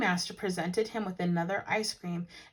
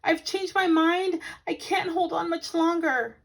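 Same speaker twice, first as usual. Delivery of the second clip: high, louder anxious plea; pleading cry; 9 semitones higher, 5 dB louder than usual. Acted. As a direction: beg to be rescued. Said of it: "cried Paddington".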